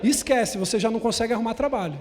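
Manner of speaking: preaching at full force. Acted. Pastor preaching loud to the church, full force. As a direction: preach it out to the congregation.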